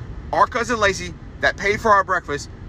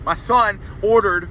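ordinary talk, nothing marked

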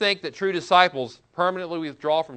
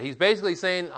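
ordinary delivery

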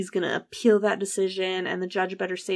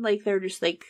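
ordinary talk, nothing marked

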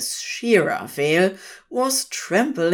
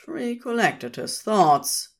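ordinary delivery, nothing marked

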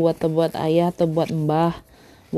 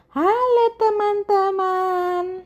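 very high, drawn-out storybook hello; high singsong greeting to friends; cheery greeting; 13 semitones higher, much slower than usual. Acted. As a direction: call out a long, high hello as a story character. Greeting voiced for story character Lala.

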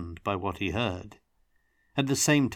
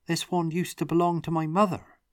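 wondering; 9 semitones higher, 3 dB louder than usual. A narrator's reading. Tomas moved by mother's stone.

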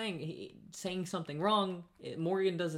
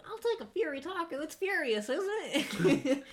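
high, put-on voice mocking the Fury taco lady; mocking customer; 11 semitones higher than usual. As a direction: mimic her saying "Fury" as if it were a real word.

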